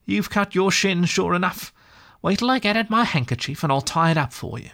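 a gentle friend tending a cut; kindly Rat. A narrator's reading. Rat voiced in story.

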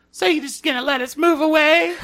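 high, laughing guilt-trip voice; mock guilt-tripping mom.

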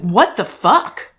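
outburst out loud. Retold or imitated. Swearing out loud in the museum at the dad, exasperated. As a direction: let it slip out in disbelief. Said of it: "I said out loud in a museum".

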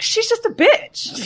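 high slow drawn-out dismissal; dismissive aside. Retold or imitated. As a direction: brush her off. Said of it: "I was like".

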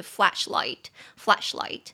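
flashlight said slowly and carefully, twice; slow model word. Shown as a demonstration. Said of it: "就是flashlight，flashlight". (慢速示范flashlight)

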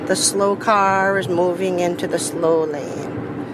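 drawn-out, trance-like command; slow incantation. Real, not acted. Willing a car aside in traffic, slow incantation. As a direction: intone it slowly, as if casting a spell.

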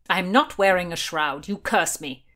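loud, mildly angry retort; indignant denial, firmly projected; growled protest. A narrator's reading. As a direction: push back with a restrained growl. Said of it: "Blackjack growled".

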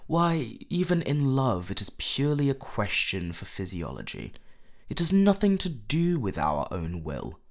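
loud, emphatic argument; animated exclamation; exclaiming. A narrator's reading. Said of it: "exclaimed Lord Henry".

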